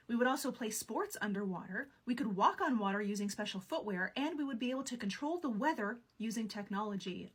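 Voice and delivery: usual speaking voice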